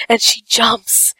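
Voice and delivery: usual speaking voice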